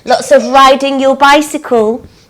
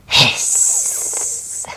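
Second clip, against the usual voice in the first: soft, long voiceless hiss; play-acted cat hissing; angry cat hiss; 10 dB quieter than usual. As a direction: let the S stream out like a cat. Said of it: "Angry cat".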